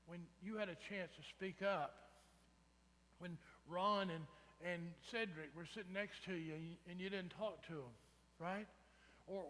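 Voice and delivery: ordinary talk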